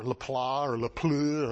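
"Laplat" as "la-plah", "Lapleu" with a French eu; tentative, low French guesses at "Laplat" and "Lapleu"; guessed French pronunciations. Shown as a demonstration. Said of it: "how you pronounce it in French".

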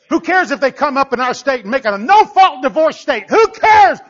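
loud, rising to a high shout; defiant preaching. Acted. Preacher dismissing divorce law, defiant shouted preaching. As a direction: fling it out as a defiant challenge.

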